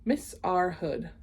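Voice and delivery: usual speaking voice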